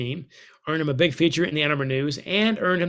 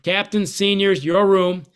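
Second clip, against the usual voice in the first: higher, louder call handing the room to the captains; 4 semitones higher, 5 dB louder, slower than usual. Retold or imitated. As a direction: bark it out like a coach leaving the room. Coach hands locker room to captains.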